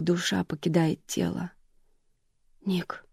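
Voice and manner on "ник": soft breathed name. A narrator's reading. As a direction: exhale his name in shock. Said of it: "выдохнула Джейн".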